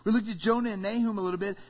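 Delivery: normal talking voice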